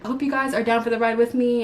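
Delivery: ordinary delivery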